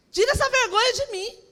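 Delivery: high, loud burst of pleading; desperate plea. Retold or imitated. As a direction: cry out in a dramatized prayer.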